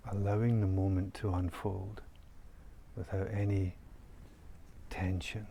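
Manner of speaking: calm, soft meditation voice with long pauses; guided meditation. Real, not acted. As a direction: guide listeners gently into stillness, leaving long silences. Leads a guided nature meditation.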